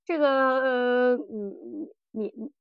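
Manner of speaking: usual speaking voice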